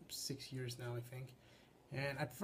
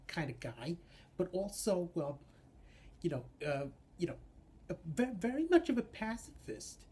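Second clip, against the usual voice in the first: stammering, higher, louder neurotic puppet voice; Woody Allen; 4 semitones higher, 4 dB louder than usual. Shown as a demonstration. Puppeteer shows the Woozle's character, nervous stammering impression. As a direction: stutter and restart like a terrified pacifist. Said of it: "my own Woody Allen impression".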